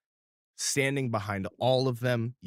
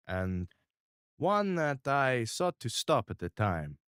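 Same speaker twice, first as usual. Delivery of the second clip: crime boss; 4 dB louder than usual. Acted. GM holds Mister R voice.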